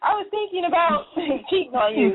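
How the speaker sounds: mock the idea with a sweet tone; sarcastic mock confession. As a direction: pretend sweet confession, laughing through it.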